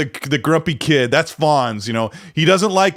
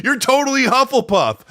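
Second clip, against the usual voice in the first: emphatic retort; 9 semitones higher than usual. Retold or imitated. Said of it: "I'm like".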